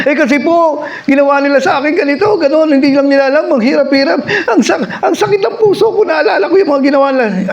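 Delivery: put-on high, whining complaint of a hurt girl; whiny camper girl. Preacher voices the crying camper girl, high and whiny.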